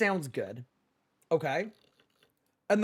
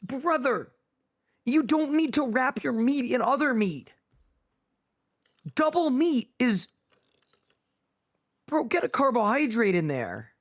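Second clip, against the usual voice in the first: exasperated scolding; 5 semitones higher than usual. Real, not acted. Scolding about double meat.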